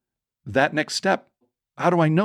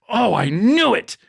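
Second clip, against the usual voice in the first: high, loud, slow dreadful oh; hammed-up alarm; mock dread; 9 semitones higher, 5 dB louder than usual. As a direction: act out sudden suspicion as if trapped. Dad fears a scam, deliverance moment.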